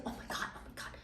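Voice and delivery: hushed frantic whisper; panicked whisper. Retold or imitated. Girls hiding their friend from the director, panicked whisper. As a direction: whisper it so the director can't hear.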